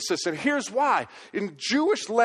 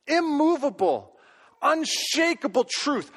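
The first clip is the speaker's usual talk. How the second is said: "immovable, unshakable truth" slow and higher, each word weighted; emphatic stress; 7 semitones higher, slower than usual. Real, not acted. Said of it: "taking it to a whole nother level".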